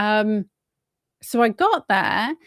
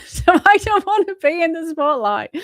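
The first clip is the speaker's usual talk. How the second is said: high, laughing nervous aside; laughing while admitting the fear; 7 semitones higher, 5 dB louder than usual. Real, not acted. Laughs about the hostile councillors.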